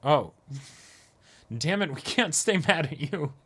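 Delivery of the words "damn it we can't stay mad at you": ordinary delivery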